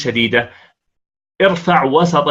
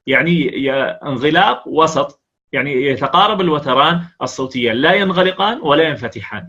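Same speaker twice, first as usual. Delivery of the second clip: ordinary talk, nothing marked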